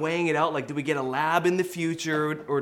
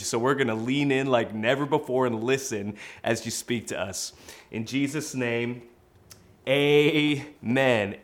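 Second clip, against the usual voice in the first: prayer; 3 semitones lower than usual. Acted. Pastor leading church in prayer, hushed and earnest.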